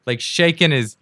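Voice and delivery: ordinary delivery, nothing marked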